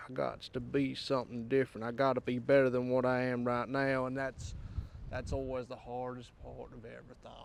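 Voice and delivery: put-on folksy southern accent, y'all style. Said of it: "enough of this accent".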